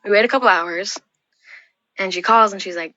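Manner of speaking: ordinary talk, nothing marked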